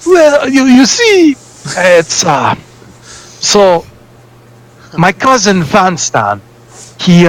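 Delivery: put-on high, hesitant foreign accent, groping for words; a lightly accented rogue fumbling through his story; nervous Yar accent spilling the family secret. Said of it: "And now he's Yar".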